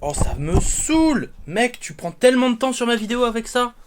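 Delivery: fed up, complaining at his friend; annoyed. Real, not acted. Camera trouble delays his video.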